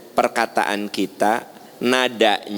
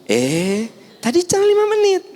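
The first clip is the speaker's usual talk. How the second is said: put-on high, irritated child's retort; rising snap. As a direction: voice jumps high and sharp. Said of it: "Naik gitu kan".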